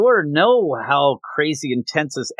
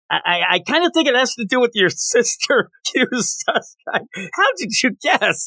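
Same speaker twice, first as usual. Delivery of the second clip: a lightly voiced giddy brother, higher; mock-sweet teasing, pitched up; 9 semitones higher than usual. Voicing gushing brother in recap.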